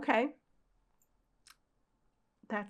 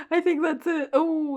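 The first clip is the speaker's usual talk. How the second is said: higher and louder through a laugh; laughing surprise; 6 semitones higher, 11 dB louder than usual. Real, not acted. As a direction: laugh as the chapter surprises you.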